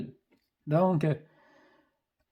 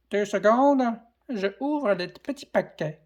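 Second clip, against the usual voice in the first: squeaky, higher chipmunk voice; 5 semitones higher than usual. Acted. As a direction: pitch it up like a chipmunk. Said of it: "Avec une voix de chipmunk".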